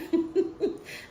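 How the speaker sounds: short breathy laugh; amused little laugh. Real, not acted. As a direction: laugh at your own story. Amused at her own retelling.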